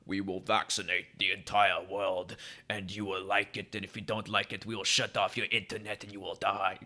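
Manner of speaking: lightly voiced movie villain threat; Bond villain voice. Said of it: "talks like a Bond villain".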